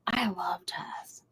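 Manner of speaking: breathy whispered aside, voice dropped away. Real, not acted. To a friend, confessing her love of jazz, in a whisper. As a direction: lean in and whisper it like a secret. Gushing about jazz festivals.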